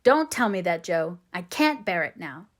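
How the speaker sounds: plain reading voice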